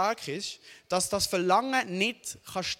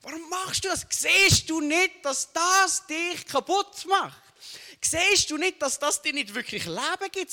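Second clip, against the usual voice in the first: exasperated shout; 8 semitones higher, 5 dB louder than usual. Retold or imitated. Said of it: "Aber ich denke so".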